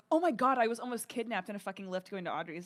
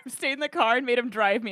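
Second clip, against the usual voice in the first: ordinary delivery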